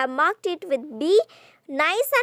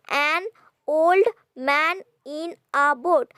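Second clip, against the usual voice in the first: put-on "an old man in a boat" slowly, each syllable apart. Shown as a demonstration. Teacher counting syllables, "an old man in a boat" slowly separated. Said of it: "total we have eight syllables".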